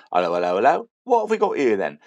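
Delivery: loud mock policeman's greeting, pitch swooping up; hammed-up copper pulling someone over; stopping guard. Guard to a driver he has stopped, hammed-up officious greeting. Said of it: "I got stopped by a guard".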